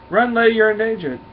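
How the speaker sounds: high and loud, pressing; urgent warning shout. Acted. Hero shouting at the lady to run from danger. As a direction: yell the warning fast.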